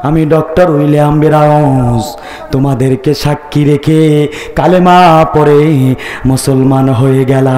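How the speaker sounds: low intoned declaration with long endings; solemn doctor. Acted. Doctor telling daughter he has embraced Islam, solemn.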